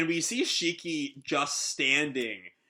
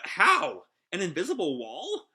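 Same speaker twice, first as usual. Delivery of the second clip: startled disbelief; 5 semitones higher than usual. Acted. Rebecca to the crew after the sudden stop, startled disbelief. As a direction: burst out baffled, ending on a rise. Acted shocked Rebecca on the ship.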